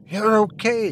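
high, tearful relief; a hint of weeping, voice lifted high; mildly tearful old husband; through tears. A narrator's reading. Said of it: "he said to her through his tears".

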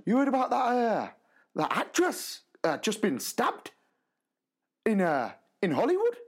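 high shocked Geordie; Geordie teacher voice. Retold or imitated. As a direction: deliver the news wide-eyed like a Geordie colleague. Geordie teacher telling news.

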